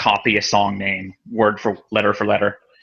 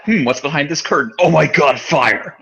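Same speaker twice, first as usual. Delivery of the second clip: mock curiosity turning shocked; 4 semitones higher than usual. Podcaster acting out opening a curtain on fire, mock shock. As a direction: wonder idly, then burst out in mock shock.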